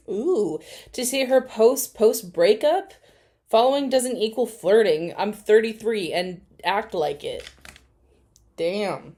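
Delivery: ordinary talk, nothing marked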